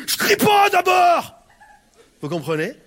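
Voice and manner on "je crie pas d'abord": high, slow furious shout; angry yell. Retold or imitated. Angry woman shouting she isn't shouting. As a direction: yell it back in denial. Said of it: "Pourquoi tu cries?".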